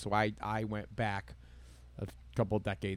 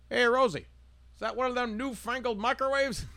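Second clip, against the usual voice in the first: high, folksy diner guy asking Rosie; diner customer voice; 13 semitones higher, 4 dB louder than usual. Retold or imitated. Man at the diner counter asking Rosie, in a high folksy voice. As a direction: play the wide-eyed diner customer. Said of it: "Guy sitting at the thing".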